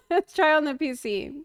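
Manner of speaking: real laughter breaking up the words; laughing while talking. Real, not acted. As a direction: try to answer through helpless giggles. Breaks up laughing after accent attempt.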